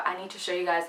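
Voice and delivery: ordinary delivery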